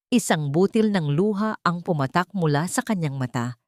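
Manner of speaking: plain reading voice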